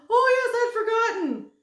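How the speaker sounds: very high, loud, fast chatter; giddy thin man. Acted. Thin man gushes to Milo, high and fast. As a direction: gush without stopping for breath.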